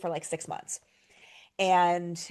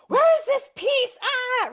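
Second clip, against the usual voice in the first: much higher, louder and slow desperate wail; frantic cry; 8 dB louder, much slower than usual. Retold or imitated. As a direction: plead for the missing piece in anguish. Frantic owner asking the vet staff.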